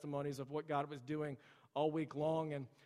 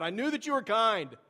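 higher, louder whiny protest to God; whining complaint; 6 semitones higher, 6 dB louder than usual. Acted. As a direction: complain like a sulking child. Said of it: "he's complaining and he's whining to God".

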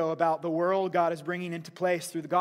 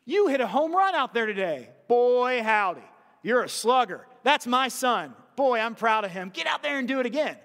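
loud, higher cheering dad; proud dad praise; 4 semitones higher, 4 dB louder than usual. Retold or imitated. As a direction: cheer on your slugger son.

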